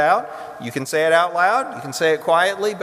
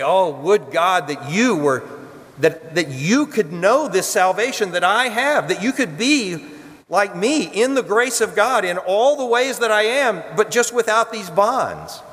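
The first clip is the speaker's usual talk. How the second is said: pleading Paul; 7 semitones higher than usual. Pretend. Paul pleading before Herod.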